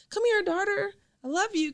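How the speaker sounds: high, sing-song loving mother; lightly voiced sugary mom; mock affectionate mom. Mom she never had greets her daughter, sweet high voice. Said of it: "got home and be like".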